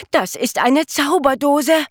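high, loud cry of delight on Zauberdose; jubilant exclamation. A narrator's reading. Jonathan thrilled about the magic tin, loud happy cry. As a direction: announce the magic tin with a burst of joy. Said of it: "jubelte Jonathan".

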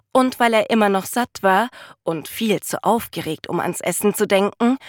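ordinary delivery

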